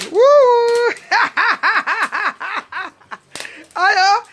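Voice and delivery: high, loud whoop and laughing shout; joyful whoop. Real, not acted. Greeting Virginie on the live, joyful whoop. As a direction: burst out with delight.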